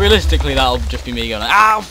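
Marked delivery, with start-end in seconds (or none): 1.5-1.8 short yelp, much higher and louder; a hammed-up pained yelp; mock scream. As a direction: yelp like something just hit you. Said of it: "Like, screaming".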